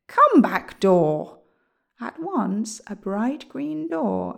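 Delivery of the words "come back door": commanding. A narrator's reading. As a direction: call out a summons to the door. Wizard commands door to reappear.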